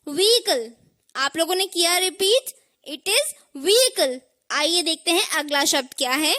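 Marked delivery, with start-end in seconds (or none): 0.1-0.7 व्हीकल as "vee-kal", h silent; correct pronunciation. Shown as a demonstration. Teacher leading repetition, व्हीकल as "VEE-kal". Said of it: "चलिए repeat करते हैं". 2.9-4.2 vehicle slowly as "vee-ikal"; silent h. Shown as a demonstration. Teacher checking learners repeated, vehicle as slow "VEE-ikal". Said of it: "एच को silent रखना है".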